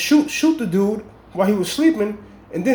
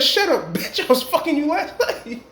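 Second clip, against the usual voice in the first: laughing quote of the gunman; 9 semitones higher than usual. Retold or imitated. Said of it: "the dude said".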